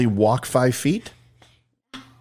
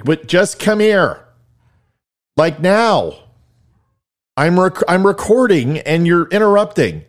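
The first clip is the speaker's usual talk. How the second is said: higher, louder, fed-up dad; exasperated; 8 semitones higher, 5 dB louder than usual. Real, not acted. Dad scolding his daughter for interrupting, exasperated.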